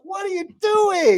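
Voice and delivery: high-pitched, incredulous outburst; exasperated mock yell. Player to a friend doing crazy things in game, exasperated. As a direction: squeal it in playful disbelief.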